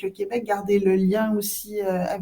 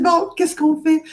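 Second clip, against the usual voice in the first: higher and louder, playfully lost; mock-helpless, pitched up; mock bewilderment; 7 semitones higher, 7 dB louder than usual. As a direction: ham up the helpless question. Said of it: "tu dis".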